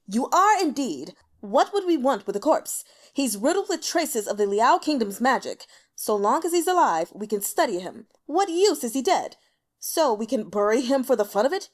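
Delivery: loud, sharp rebuke; angry scolding. Acted. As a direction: tear into him with scorn. Emperor berates the elder.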